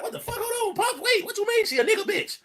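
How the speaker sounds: put-on high, startled kid voice; confused son. Son questioning his father, high and bewildered. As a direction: pitch it way up like a bewildered boy. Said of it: "he go like".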